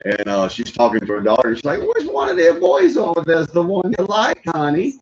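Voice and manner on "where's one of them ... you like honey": higher, sing-song mom voice; Sandler's mom voice. Retold or imitated. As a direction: coo it like a nosy mom. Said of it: "he's got this character. It's his mom".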